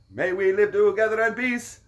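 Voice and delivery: high, loud, put-on Russian accent; mock Russian toast. Toasting to living together, loud mock Russian accent. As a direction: proclaim it in a hammed-up Russian accent.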